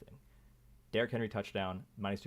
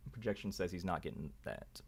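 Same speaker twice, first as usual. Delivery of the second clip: ordinary talk, nothing marked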